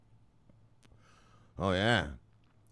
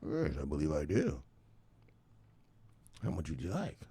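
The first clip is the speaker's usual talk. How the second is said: lower, soft, unhurried dealer voice; mock smooth dealer; 8 semitones lower, 12 dB quieter than usual. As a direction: purr the offer like a dealer. Dealer answering addict.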